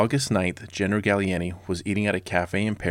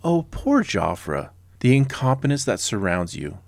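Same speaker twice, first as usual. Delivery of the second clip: higher, drawn-out mock pity; thick sarcasm; 5 semitones higher, 4 dB louder than usual. Shown as a demonstration. Host mock-consoling the commander, dripping with sarcasm. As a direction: lay the false sympathy on thick. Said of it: "I'm trying to spread it on thick".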